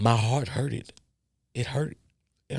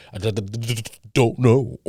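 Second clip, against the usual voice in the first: 1 mock stutter; 4 dB louder than usual. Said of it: "playful stutter".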